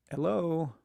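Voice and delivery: ordinary talk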